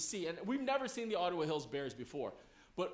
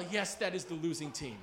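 usual speaking voice

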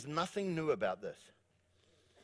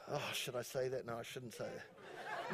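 muttered aside; 7 semitones lower, 15 dB quieter than usual. Real, not acted. Preacher second-guessing a risky joke to himself, muttered. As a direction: drop it right down as if debating with yourself.

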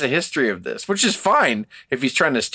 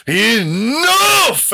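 much higher and louder, hammed-up bellow; angry shout; 8 semitones higher, 5 dB louder than usual. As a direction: shut the whole match down with one yell. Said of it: "that match made Inoki go".

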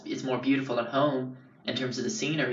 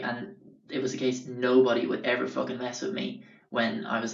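normal talking voice

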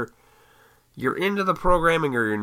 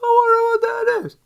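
high, put-on wondering voice; mock wondering; 6 dB louder than usual. Telling listeners to look up every day, mock wondering. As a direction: play a curious onlooker, pitched way up. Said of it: "look up no matter where you live and say".

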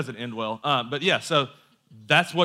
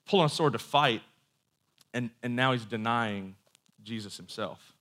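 ordinary delivery

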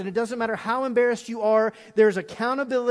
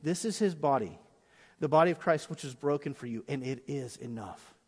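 softer, fading to a hush; quiet modelled communion words; 6 semitones lower, 6 dB quieter than usual. Shown as a demonstration. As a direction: say it gently to the person beside you. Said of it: "the first one to look and say".

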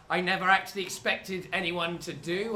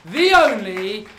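slow, loud, drawn-out showman intro; grand announcement; 7 semitones higher, 9 dB louder, much slower than usual. Acted. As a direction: announce it like a big act. Host announcing the act.

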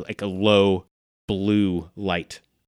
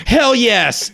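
loud, high exultant battle cry; Judas cheering; 13 semitones higher, 7 dB louder than usual. Acted. Game master voices Judas swinging at the zombies, exultant. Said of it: "And Judas says".

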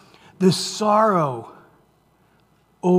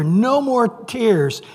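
"no more tears" drawn out slowly, pitched high; emphatic; 6 semitones higher, much slower than usual. Acted. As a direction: stretch "no more tears" high and slow, then fall. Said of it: "He makes it clear".

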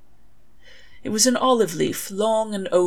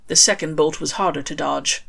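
usual speaking voice

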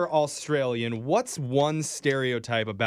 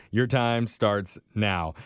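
slow announcer read; 4 semitones lower, much slower than usual. Acted. Host starts the quiz timer.

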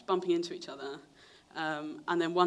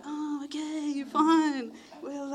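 awkward reply; 9 semitones higher, 4 dB louder, much slower than usual. Retold or imitated. Teens awkwardly backing off.

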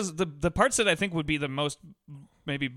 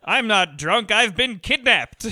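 put-on louder, slow old-movie leading man; drawn-out protest of a tipsy gentleman; Cary Grant impression; 5 semitones higher, 6 dB louder, slower than usual. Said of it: "We're all doing great Cary Grant impressions here".

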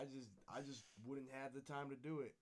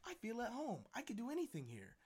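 lightly voiced shopper, pitched higher; imagine a customer feeling at ease, higher voice; contented shopper; 7 semitones higher than usual. Voicing a comforted shopper's thoughts.

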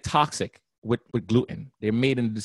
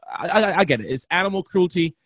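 usual speaking voice

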